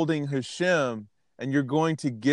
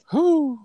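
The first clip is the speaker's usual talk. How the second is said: shaky sigh; 13 semitones higher, 5 dB louder than usual. Real, not acted. Recovering after crying.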